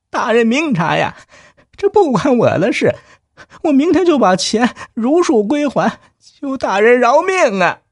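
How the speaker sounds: high, desperate begging wail; pleading. Acted. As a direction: beg for your life. Clerk kneels begging for mercy.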